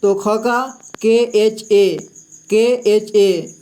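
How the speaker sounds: के एच ए spelled letter by letter, slowly; slow letter-by-letter spelling for students. Shown as a demonstration. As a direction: spell ख as के एच ए deliberately. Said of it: "ख को उच्चारण करते हैं".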